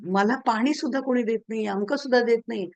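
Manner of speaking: normal talking voice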